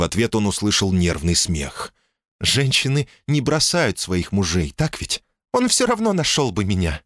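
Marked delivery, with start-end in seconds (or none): 2.4-5.2 lightly voiced wife, higher; calm, sensible woman speaking up high; woman's voice. 5.5-7.0 put-on high squeaky little girl. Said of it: "писклявым голосом маленькой девочки".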